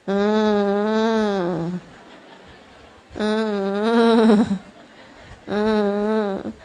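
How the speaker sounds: low droning whimper, held on one note; imitated unserious crying; half-hearted child's whine. Preacher shows mother a child's unconvincing cry, low drone. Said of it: "nangisnya kayak gitu tuh".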